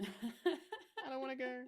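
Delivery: slow laughing whimper. Retold or imitated. Repeats quote, slower with a laugh.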